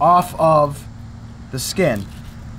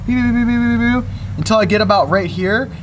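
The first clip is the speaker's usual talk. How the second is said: playful beeping; 4 semitones higher than usual. Pretend. Imitating the knife cuts.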